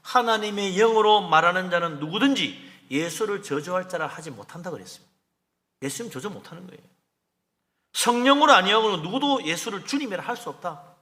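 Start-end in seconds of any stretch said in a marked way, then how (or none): 0.0-4.7 scripture reading. Acted. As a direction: intone the verse deliberately. 바울이 뭐라 그랬습니까.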